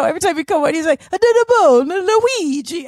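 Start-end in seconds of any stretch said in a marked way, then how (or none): 1.1-2.9 high, hammed-up Italian accent; mock a film star's overdone Italian accent. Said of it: "It is [name]".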